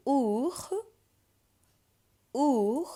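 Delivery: "Our" said with a French oo vowel; oo vowel demo. Shown as a demonstration. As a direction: model "Our" as oor, high and loud. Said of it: "oo sound".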